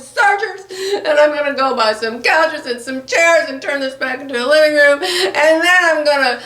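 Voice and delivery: wailing and half-laughing through mock tears; retold crying. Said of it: "And then I started crying".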